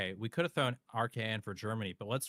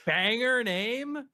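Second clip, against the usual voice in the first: loud, high, drawn-out hype call; hyped; 8 semitones higher, 7 dB louder, slower than usual. Real, not acted. Streamer hyping a coach's name to chat, loud drawn-out cheer. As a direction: stretch it out like you love the name.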